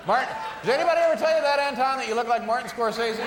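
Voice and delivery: loud, fast, high mock call; mock shout. Host jokingly yells at the drummer. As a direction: blurt the wrong name out loud.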